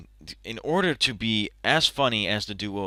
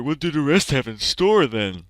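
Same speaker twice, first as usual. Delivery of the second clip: normal talking voice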